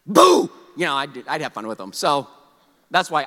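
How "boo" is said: high, loud mock spooky boo; a playful ghost's boo. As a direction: jump out with a pretend boo. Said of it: "show up and be like".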